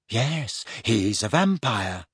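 soft, slow, unbothered statement; matter-of-fact. A narrator's reading. Luna confirming her vampire theory to Harry, matter-of-fact. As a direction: state it softly as plain fact. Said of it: "said Luna matter-of-factly".

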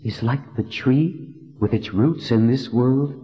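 put-on a reverent young woman asking. A narrator's reading. Heroine voiced in story.

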